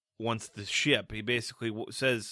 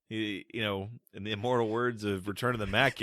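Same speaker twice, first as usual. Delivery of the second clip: normal talking voice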